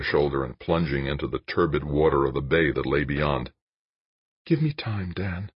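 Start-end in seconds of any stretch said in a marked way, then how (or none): 4.5-5.5 high, soft plea in a woman's voice; pleading. A narrator's reading. Jane begs Dan for time, gentle high plea. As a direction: plead gently, pitch raised. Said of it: "she pleaded".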